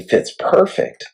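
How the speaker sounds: high, slow and pleased; pleased rising fits perfect; delighted. Real, not acted. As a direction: savor it like a happy discovery. Delighted at the receiver fitting.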